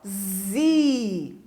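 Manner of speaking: ZI drawn out long on its own; stressed syllable. Shown as a demonstration. Teacher pointing out the tonic syllable of cafezinho, ZI stressed and held. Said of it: "a sílaba tônica de cafezinho é o zi".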